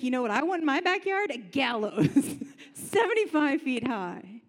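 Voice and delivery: a hammed-up proud boast with a laugh; gloating Haman. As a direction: play the vain villain showing off his gallows. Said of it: "He's like".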